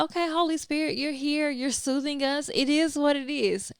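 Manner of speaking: light, lifted voice easing into calm; soothed inner voice. Retold or imitated. To the Holy Spirit during worship, calm and content. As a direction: settle in, content and at ease.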